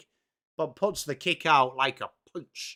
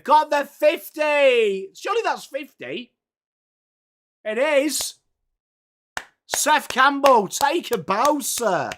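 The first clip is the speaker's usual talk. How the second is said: higher, louder and slower, celebrating the goal; excited cheering; 8 semitones higher, 6 dB louder, slower than usual. Real, not acted. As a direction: call it like a thrilled fan hailing the scorer. Team scores, streamer celebrates.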